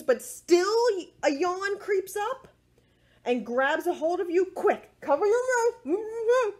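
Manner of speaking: bright, lilting storybook voice for little ones; reading to children. A narrator's reading. Librarian reading a yawn book to children, lilting storybook voice.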